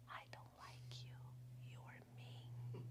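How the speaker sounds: whispered, barely audible. Retold or imitated. Child repeats it to mom, hushed whisper. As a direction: hide the words from God in a whisper. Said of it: "I whispered".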